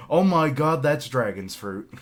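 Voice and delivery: loud, high burst of surprise; real excited outburst. Real, not acted. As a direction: burst out loudly in real amazement. Sudden excited recognition of the fruit.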